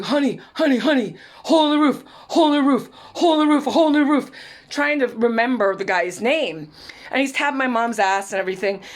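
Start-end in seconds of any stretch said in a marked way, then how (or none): 0.0-4.3 loud, high, insistent repeated calling; like a maniac. Retold or imitated. Stepfather trying to get mom's attention in a store, maniacally insistent. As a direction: pester someone urgently, louder with each repeat. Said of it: "standing there like a maniac".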